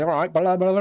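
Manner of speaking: mock West Country mumbling, rising in pitch; hammed-up West Country gabble; West Country accent. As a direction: imitate an incomprehensible West Country farmer. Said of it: "and he talks like that".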